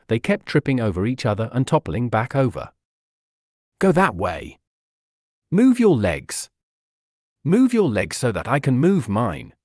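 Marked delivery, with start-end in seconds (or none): 3.8-9.5 an understated frantic yell; mild yell. A narrator's reading. Noelle yelling at Courage while both are tangled, mildly frantic. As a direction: bark orders while tangled up, lightly. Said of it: "Noelle yelled at Courage".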